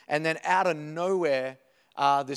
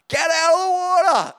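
very high, full-volume holler; mum yelling; 14 semitones higher, 11 dB louder than usual. Retold or imitated. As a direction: yell down to the kids like an urgent mum. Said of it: "she can yell, my mum".

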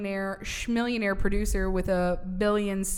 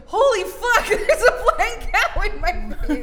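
high laughing mock panic; shocked Mary; 10 semitones higher, 7 dB louder than usual. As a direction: blurt it out in giggling shock. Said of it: "you were a little closer with".

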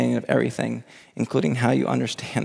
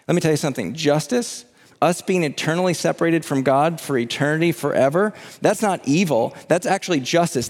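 ordinary delivery

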